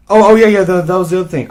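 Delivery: mocking mom. Mocking the mom who keeps deflecting, high and loud. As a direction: parody her dismissive babble. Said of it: "she kept saying".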